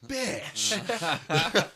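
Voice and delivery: loud, high, drawn-out exclamation; mock outrage. Host retelling seeing his friend's studio, comic drawn-out outrage. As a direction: stretch the curse out slowly, high and loud.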